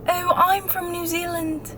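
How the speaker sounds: high-pitched attempt at a New Zealand accent; imitated New Zealand accent. Stranger tells traveler where she's from, imitated New Zealand accent. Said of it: "I'm probably doing the accent wrong".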